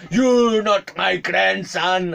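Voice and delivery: loud, high, slow mock accusation; flipped-out old man. Old man rejecting his supposed grandson, flipped out. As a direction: stretch out every word in imitated outrage. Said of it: "then he suddenly flipped".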